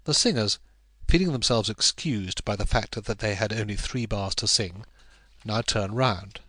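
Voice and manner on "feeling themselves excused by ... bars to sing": usual speaking voice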